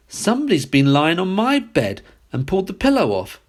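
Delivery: a lightly voiced indignant mother; mother bear voice. A narrator's reading. Mother bear finds her bed mussed, lightly voiced. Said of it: "said she in her middle-sized voice".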